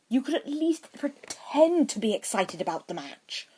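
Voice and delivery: whining. A narrator's reading. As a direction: whine at your friend. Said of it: "Draco whined".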